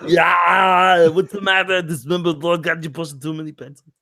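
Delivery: a hint of Dutch, shouted then trailing off; lightly voiced gruff gang boss; Dutch impression. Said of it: "You do a Dutch".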